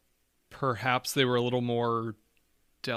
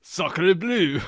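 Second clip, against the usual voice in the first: hammed-up French accent, higher and louder; mock French accent; 6 semitones higher, 6 dB louder than usual. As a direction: play a cartoon Frenchman saying Sacre bleu. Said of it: "do it in a French accent".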